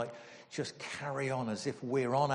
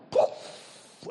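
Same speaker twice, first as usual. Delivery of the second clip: poof; 7 dB louder than usual. Retold or imitated. Dessert set on fire.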